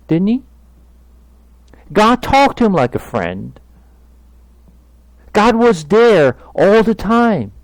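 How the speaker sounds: impassioned sermon climax, high and loud; full-force preaching. Acted. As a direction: drive each point home to the congregation.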